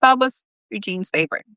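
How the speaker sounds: plain reading voice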